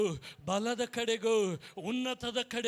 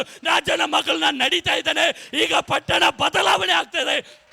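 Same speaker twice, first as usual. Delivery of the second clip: loud, building preacher's cry, higher by the end; preaching at full force; 4 semitones higher, 7 dB louder than usual. Acted. Pastor rousing the congregation, shouted preaching. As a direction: proclaim it to the whole hall, rising.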